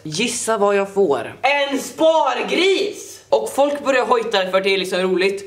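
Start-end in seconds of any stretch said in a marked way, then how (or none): 1.4-3.1 slow, high drawn-out reveal; dramatic reveal. Acted. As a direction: stretch out the punchline. Punchline reveal of gift. (långsamt dramatiskt avslöjande)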